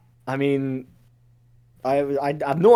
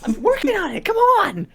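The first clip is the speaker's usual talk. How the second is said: high, squeaky protest; harried underling. Acted. Demon underling nagged about the work, high harried protest. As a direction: squeal it defensively.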